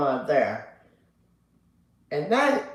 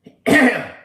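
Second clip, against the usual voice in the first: loud throat clear; 10 semitones higher, 11 dB louder than usual. Real, not acted. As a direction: clear your throat hard. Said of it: "Excuse me".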